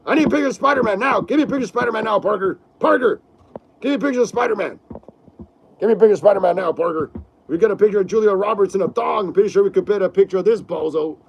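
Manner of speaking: loud, very fast editor barking orders; J. Jonah Jameson impression. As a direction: rattle it off like a furious newspaper boss. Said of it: "I gotta practice it more".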